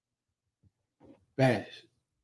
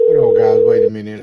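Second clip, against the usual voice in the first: much louder, hurried hold on; urgent interruption; 12 dB louder, faster than usual. Real, not acted. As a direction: stop everything, loudly. Host abruptly interrupts the show.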